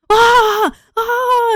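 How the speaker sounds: high, loud startled cries; mock kids' shriek. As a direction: shriek like shocked children. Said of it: "And all the kids are like".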